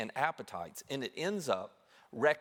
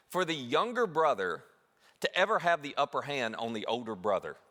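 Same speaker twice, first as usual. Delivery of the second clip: ordinary delivery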